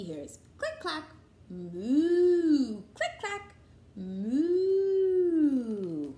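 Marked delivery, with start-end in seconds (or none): 1.5-2.8 cow moo. Acted. Cow sound in read-aloud book. 3.9-6.1 cow moo. Acted. Cow sound in read-aloud book.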